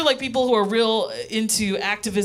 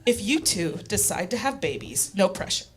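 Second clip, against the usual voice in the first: mom's serious lecture; 4 dB quieter than usual. Retold or imitated. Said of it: "this is a real reenactment of her reaction".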